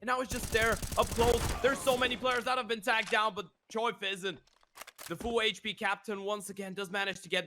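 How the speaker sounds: high, rising clutch commentary; hyped play-by-play. Real, not acted. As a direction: build the hype as the clutch unfolds. Clutch round call, rising hype.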